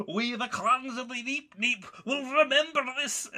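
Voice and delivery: put-on Scottish accent. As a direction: declare it like a rebel clan chief. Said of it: "in their Scottish accent".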